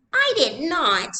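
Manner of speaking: put-on high, loud, slow child's protest; a small girl haughtily denying it; haughty little girl. A narrator's reading. Little sister haughtily denying to her mother, high and loud. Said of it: "Kirsty said haughtily".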